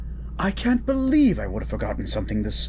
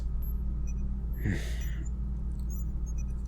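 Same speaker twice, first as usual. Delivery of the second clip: sigh; 3 semitones lower, 6 dB quieter than usual. Acted. Embarrassed apology to his date.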